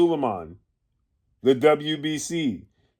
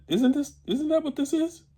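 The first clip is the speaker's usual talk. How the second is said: high, soft voice climbing upward; mock-innocent question; 13 semitones higher, 5 dB quieter than usual. Commentator hinting at the word he withheld, mock-innocent question. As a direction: feign innocence in a small, high voice.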